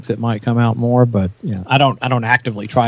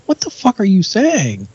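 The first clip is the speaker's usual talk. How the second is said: baffled outburst; 8 semitones higher than usual. Retold or imitated. Retold confusion at thick accent.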